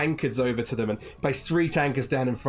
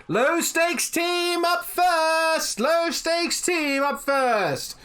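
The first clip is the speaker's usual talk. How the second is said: high, slow, drawn-out announcer call; innkeeper calling out; much slower than usual. Acted. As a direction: sing it out to the whole tavern like a ring announcer. Innkeeper ringing bell, calling out.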